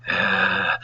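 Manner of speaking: a dull groan from the bouncer as the needle goes in; imitated grunt. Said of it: "And he goes".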